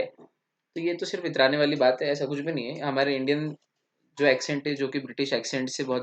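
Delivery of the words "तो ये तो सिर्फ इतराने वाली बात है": ordinary talk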